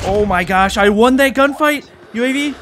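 high, loud thrilled shout; excited. Real, not acted. Thrilled after winning fight.

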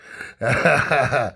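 short laugh at own joke. Real, not acted. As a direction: chuckle at your own joke.

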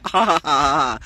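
spoken ha ha laugh. Real, not acted. Laughing aloud.

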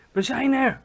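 put-on high, loud excited girl. A narrator's reading. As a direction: burst out thrilled at arriving. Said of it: "fluisterde Sophie opgewonden".

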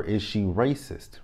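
normal talking voice

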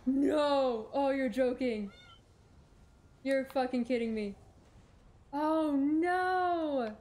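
loud, exasperated cries of disbelief; frustrated outburst. Real, not acted. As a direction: yell at the game for betraying you. Loses progress, frustrated outburst.

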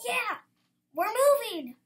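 high, loud, thrilled cry; excited announcement. A narrator's reading. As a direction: burst out with big news. Dad announces amazing news excitedly.

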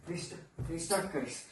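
quiet, inward resolve; hushed self-talk. Acted. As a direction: say it under the breath to yourself. Closing self-talk, repeating the resolve.

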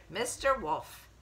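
ordinary delivery, nothing marked

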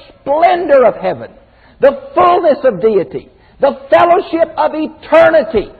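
high, loud fervent preaching climax. Real, not acted. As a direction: preach it with rising fire.

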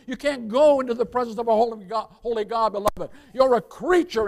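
usual speaking voice

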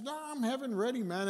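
put-on a touch of a bright old lady; cheerful resident. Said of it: "She says".

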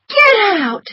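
high, loud outraged shriek; furious cry. A narrator's reading. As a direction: throw him out in fury. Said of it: "she cried".

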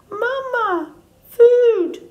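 put-on high, tiny baby-bird voice; peeping gosling. A narrator's reading. Gosling calling the robot mother, high peep. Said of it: "peeped the gosling".

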